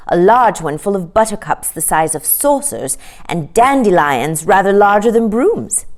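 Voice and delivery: usual speaking voice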